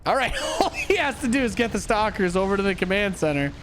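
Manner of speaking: usual speaking voice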